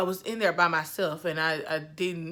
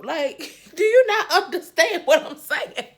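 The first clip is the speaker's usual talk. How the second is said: laughing disbelief; 9 semitones higher, 5 dB louder than usual. Retold or imitated. Retold arguing with anesthesiologist.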